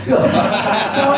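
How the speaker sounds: ordinary talk, nothing marked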